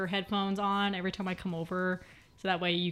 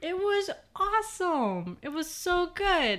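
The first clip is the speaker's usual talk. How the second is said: much higher, slow gushing praise; 11 semitones higher, 3 dB louder, slower than usual. Real, not acted. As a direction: rave about it like a fan. Defending Jurassic World.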